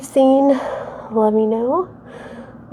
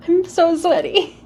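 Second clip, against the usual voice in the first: laughing whisper, out of breath; breathy laugh; 6 semitones higher than usual. Real, not acted. Laughing after sweaty flow.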